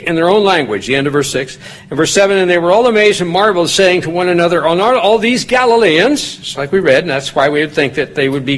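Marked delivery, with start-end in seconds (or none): 2.4-6.4 raised, ringing Bible reading; scripture reading. Acted. Preacher reading Acts to the congregation, raised and ringing. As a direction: read the verses out to the congregation with lift.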